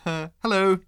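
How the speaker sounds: high, hesitant hello; a nervous little hello from two; timid greeting. Acted. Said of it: "they said".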